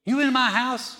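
loud, high-pitched indignant question; mock accusation. People walk up to the preacher, loud mock accusation. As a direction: belt it out high in mock suspicion. Congregant confronting the preacher, acted.

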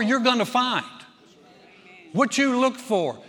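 a pastor building to a loud, fervent climax; preaching at full force. Acted. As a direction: preach it to the whole room.

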